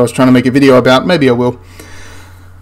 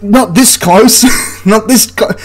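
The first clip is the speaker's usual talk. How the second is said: higher, drawn-out protest breaking into laughter; incredulous objection; 7 semitones higher, slower than usual. Real, not acted. Objects to absurdly short firing distance.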